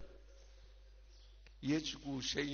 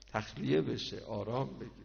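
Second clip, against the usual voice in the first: usual speaking voice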